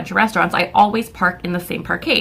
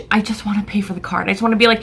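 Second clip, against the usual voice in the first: ordinary talk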